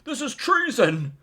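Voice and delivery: high, loud mock shriek; shouting queen. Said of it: "she starts shouting".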